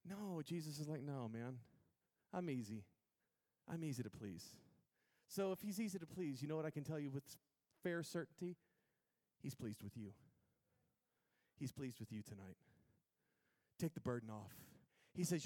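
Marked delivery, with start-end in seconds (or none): 1.0-4.5 low, calm reassurance; Jesus, easygoing. Acted. Jesus answers the world's demands, calm and easy. 9.4-12.6 low, soft and sincere; tender reassurance. Real, not acted. Preacher tells the congregation God is pleased, softly.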